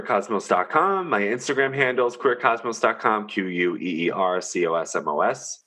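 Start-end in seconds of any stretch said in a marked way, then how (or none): 3.3-5.6 spelling it out. Shown as a demonstration. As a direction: spell each letter clearly. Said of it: "spelling it out loud".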